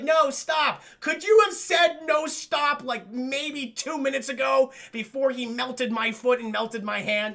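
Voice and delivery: high, loud mock outrage; exasperated protest. Acting belated protest of maimed victim.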